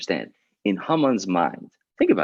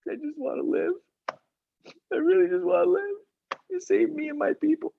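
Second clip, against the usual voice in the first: high, thin crying voice; tearful plea. Acted. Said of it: "she starts crying".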